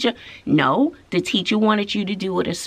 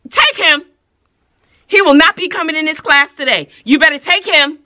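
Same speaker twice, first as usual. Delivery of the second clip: loud, higher-pitched stern dismissal; firm order; 5 semitones higher, 8 dB louder than usual. Retold or imitated. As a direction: order the student out, loudly. Teacher orders student removed.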